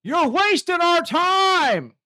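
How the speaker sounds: loud, high, drawn-out shout; heckler's yell. Retold or imitated. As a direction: yell it out slowly across a packed courtroom. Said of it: "some guy just yells out".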